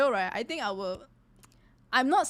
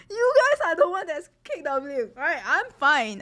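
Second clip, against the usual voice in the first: high, loud teasing through laughter; cracking up while calling out chat; real laughing protest; laughing accusation; 7 semitones higher, 7 dB louder than usual. Real, not acted.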